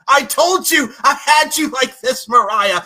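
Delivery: high, loud gloating shout of Fool; a heel turning on her partner, roaring it out; villain yell. Retold or imitated. Wrestler turning on her rival in a loud mocking yell. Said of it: "she could be like".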